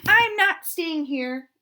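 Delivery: loud, high, drawn-out wail. Acted. Duck wails to the others as he leaves, slow and loud. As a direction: wail it like a hurt little duck. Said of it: "wailed the duck".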